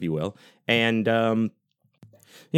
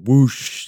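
loud, rising whoosh noise; imitated whoosh; 5 semitones higher, 7 dB louder than usual. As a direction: mimic a whoosh with your voice. Imitating a candy chute noise.

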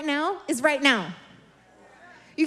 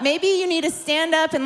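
usual speaking voice

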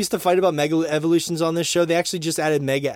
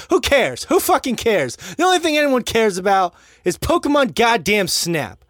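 loud, higher emphatic rant; 7 semitones higher, 6 dB louder than usual. Real, not acted. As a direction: declare it loudly, worked up. Ranting about Pokemon Snap.

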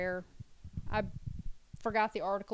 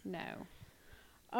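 whispered; 5 semitones lower, 17 dB quieter than usual. Real, not acted.

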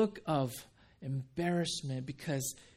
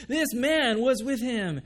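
put-on high, loud, slow accusing voice of the servant girl; 7 semitones higher, 8 dB louder than usual. Servant girl accusing Peter by the fire, loud and slow. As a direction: point him out, slow and loud. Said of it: "looked closely at him and said".

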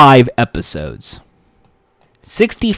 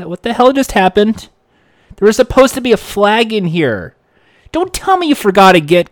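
exasperated host; 6 semitones higher than usual. Retold or imitated. Host finds no flag.

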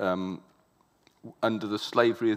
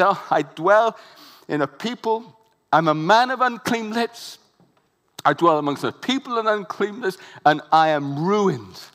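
despairing Isaiah; 7 semitones higher, 7 dB louder than usual. Acted. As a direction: wail it out, undone before God. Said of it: "And he said".

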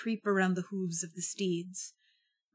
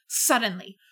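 ordinary delivery

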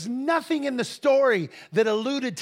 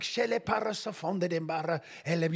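quick flowing tongues; speaking in tongues; 2 semitones lower than usual. Retold or imitated. Preacher praying over the girl in tongues, fluid. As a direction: rattle off the prayer language. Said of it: "So I'm just like".

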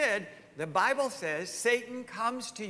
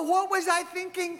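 mock regret; 11 semitones higher, 5 dB louder than usual. Mock self-reproach.

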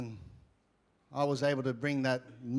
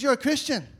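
high, louder incredulous question; mimicked surprise; 9 semitones higher, 6 dB louder than usual. Sauna stranger learning he pastors a church, mimicked surprise. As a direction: mimic a stranger taken aback.

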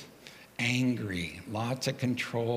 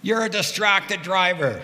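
slow, loud, higher accusing yell; retold yell; 7 semitones higher, 5 dB louder than usual. Runner yelling at the passing cyclist, slow loud yell. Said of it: "she yelled at me".

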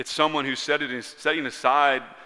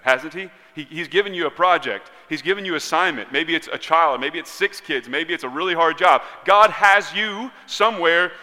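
higher, louder, driving preacher delivery; forceful preaching; 6 semitones higher, 9 dB louder than usual. Real, not acted. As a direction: build the list with raised preaching intensity.

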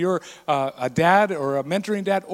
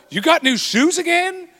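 high, louder incredulous question; disapproving tone; 10 semitones higher, 4 dB louder than usual. Shown as a demonstration. Dad showing daughters bad tone, disapproving. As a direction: demand it in pitched-up disbelief. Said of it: "Way different, same words".

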